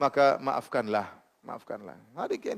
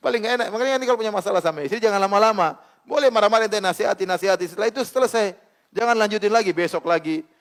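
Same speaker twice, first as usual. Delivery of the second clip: high, loud, driving sermon voice; fervent preaching; 6 semitones higher, 5 dB louder than usual. Acted. Ustadz urging husbands and wives to forgive, in full-force preaching. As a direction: preach it out to the congregation.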